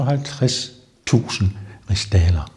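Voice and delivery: hushed, secretive aside on the sum; whisper. Shown as a demonstration. Guide whispers the count's huge debt to tourists. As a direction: breathe the amount like a secret.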